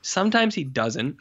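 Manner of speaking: ordinary talk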